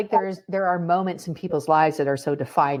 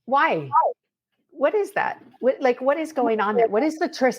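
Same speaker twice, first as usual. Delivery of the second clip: high, bright wonder; amazed wonder; 9 semitones higher than usual. Real, not acted. Wondering at babies.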